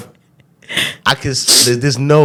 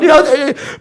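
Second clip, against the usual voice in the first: excited; 4 dB louder than usual. Retold or imitated. Friends cheering him on.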